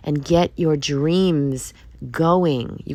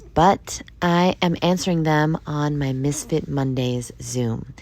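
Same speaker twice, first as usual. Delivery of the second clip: normal talking voice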